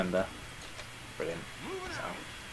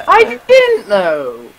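high, slow, drawn-out whine; long high-pitched mock protest; protesting wail; much slower than usual. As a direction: wail your excuse slowly, way up high. Protesting after being told why.

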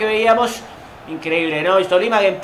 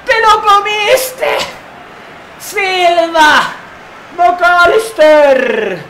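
drawn-out cry; 7 semitones higher, much slower than usual. Real, not acted. Missed chance, drawn-out cry.

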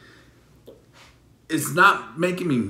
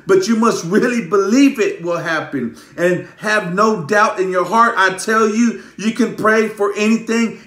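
higher, driven preacher's delivery; fervent scripture preaching; 3 semitones higher, 4 dB louder than usual. Real, not acted. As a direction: preach the verse with rising force. Preaches scripture at full force.

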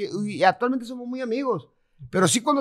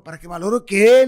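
loud challenging comeback; louder retold furious question; retold indignant retort; 10 dB louder than usual. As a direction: snap back at the guard. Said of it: "le dije, decía yo".

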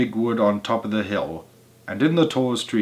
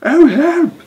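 very high, loud scream for help; terrified scream; 11 dB louder than usual. A narrator's reading. Missus Twit screams for help, very high and loud. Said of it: "screamed Missus Twit".

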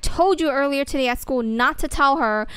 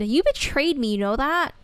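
usual speaking voice

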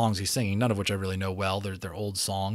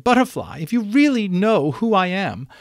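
much higher, louder pleading unicorn; 12 semitones higher, 7 dB louder than usual. A narrator's reading. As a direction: beg him for an answer. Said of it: "she pleaded".

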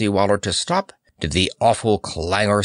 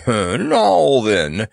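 chuckling; 3 semitones higher, 4 dB louder than usual. A narrator's reading. Monarch turning to his captive wizard, chuckling. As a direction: chuckle leading into the words. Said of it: "chuckled the Monarch of Mudge".

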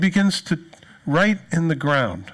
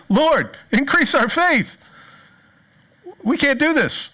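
higher, slow, pleading cry; desperate plea; 12 semitones higher, slower than usual. Retold or imitated. Disciples begging the Lord for more faith, desperate. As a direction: beg for help as if it's beyond you.

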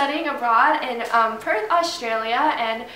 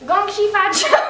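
higher, laughing mock Chinese accent; put-on Chinese accent; 7 semitones higher, 4 dB louder than usual. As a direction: push the Chinese accent, giggling through it. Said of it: "say it in a Chinese accent".

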